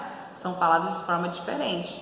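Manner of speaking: ordinary delivery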